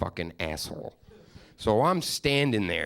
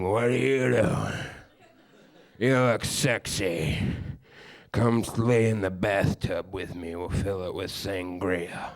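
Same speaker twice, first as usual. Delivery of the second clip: put-on drunk sleazy pickup. Drunk Brian hitting on girls.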